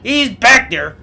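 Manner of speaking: high, loud, pushy tip-off; loud urgent warning. Acted. Rosimul tipping off Fred and Jed about the hunter, loud and urgent.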